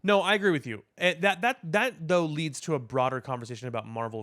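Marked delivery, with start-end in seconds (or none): none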